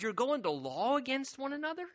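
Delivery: incredulous Paul. Retold or imitated. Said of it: "he says".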